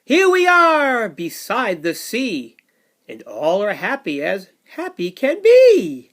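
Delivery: put-on lively read-aloud for little kids; sing-song storybook rhythm; bouncy picture book narration; reading to children.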